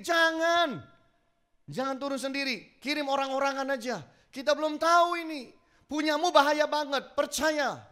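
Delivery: high, loud retold pleading; urgent warning. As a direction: warn him off insistently, pitched high. Retelling urgent warnings to the jumper.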